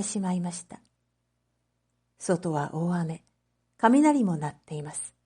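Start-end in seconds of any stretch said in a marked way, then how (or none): none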